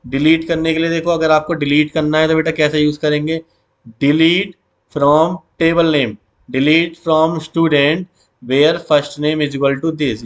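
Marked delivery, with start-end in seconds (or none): 4.0-10.2 very slow, word by word; slow dictation. Shown as a demonstration. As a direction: spell it out for students to copy. Teacher dictating SQL query.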